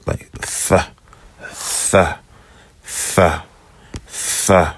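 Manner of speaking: "th" breathed out soft and voiceless; lisped TH sound. Shown as a demonstration. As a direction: repeat a whispered lisping "th". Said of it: "lispy S".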